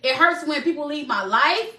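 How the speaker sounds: usual speaking voice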